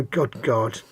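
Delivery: normal talking voice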